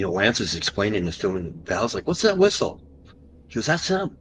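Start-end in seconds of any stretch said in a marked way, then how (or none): 2.1-2.8 much higher, urgent question; hammed-up alarmed question; mock startled caller. Friend Val asks on phone. 3.7-4.2 much higher, tense answer; hammed-up alarm; excited reply. Lance answers about whistle.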